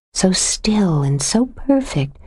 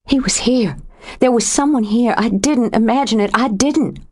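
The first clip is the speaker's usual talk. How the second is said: higher, breathless insistence, starting in a whisper; frightened, pleading to be believed; panicked; 6 semitones higher than usual. A narrator's reading. Jo insists to Kirby the man was there, panicked. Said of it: "With a panicked sound in her throat".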